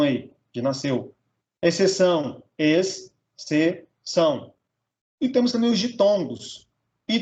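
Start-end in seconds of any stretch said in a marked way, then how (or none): 2.6-4.5 "ex", "se", "são" as three separate beats; split into syllables. Shown as a demonstration. Teacher dividing exceção, "ex, se, são" syllable by syllable. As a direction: pause after each of "ex", "se", "são". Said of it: "separar um em cada sílaba".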